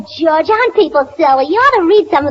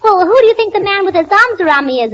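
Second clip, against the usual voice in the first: usual speaking voice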